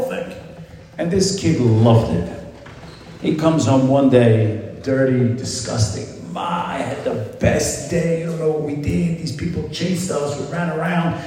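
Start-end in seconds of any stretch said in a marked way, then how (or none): 6.3-11.1 put-on high, breathless rush of boyish excitement; excited kid. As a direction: gush like a thrilled little boy. Kid gushing about his day.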